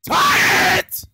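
loud, high command; shouted order. Acted. Telling partner to tie it, shouting. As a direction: bark the order sharply.

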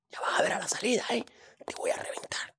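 hushed menacing whisper; whispered threat. Pretend. Bully to classmate out of the teacher's earshot, whispered threat. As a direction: hiss the threat for later.

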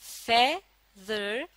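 Feather split slowly into two parts, फे, then दर; syllable-by-syllable feather, held apart for a learner; sounded out in parts. Shown as a demonstration. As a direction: say feather slowly, pausing between फे and दर. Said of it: "फे और दर की आवाजों को".

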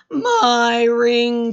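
loud, drawn-out wail; big acted cry of dismay; anguished cry. A narrator's reading. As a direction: wail over a lost treasure. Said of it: "cried Miss Plum".

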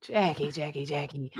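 low, soft cat call. Pretend. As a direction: coax it out like a purring cat. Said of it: "In my cat voice".

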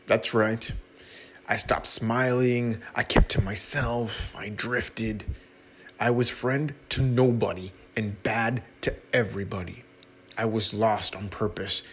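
ordinary delivery

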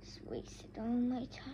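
whispered, defeated mumble; dejected whisper. Real, not acted. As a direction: breathe it out, giving up. Gives up after being skipped.

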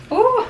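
a high frightened ooh; real nervous squeal; startled high squeal. Real, not acted. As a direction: squeal as if the bee buzzed past. Startled by a bee.

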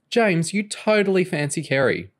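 high-pitched teasing accusation. Acted. Nicole teases James about Kerry.

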